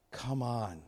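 ordinary talk

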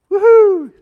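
a bright, high whoop of joy; excited woohoo. Real, not acted. Preacher cheering to the congregation about today, excited whoop.

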